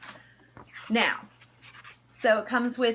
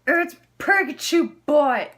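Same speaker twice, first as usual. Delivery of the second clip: ordinary delivery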